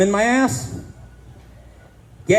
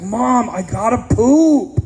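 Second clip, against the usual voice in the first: mock whiny kid, higher, slow; 5 semitones higher, 4 dB louder, slower than usual. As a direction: whine it out like a desperate little boy. Kid urgently tells his mom.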